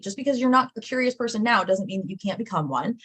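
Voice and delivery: ordinary delivery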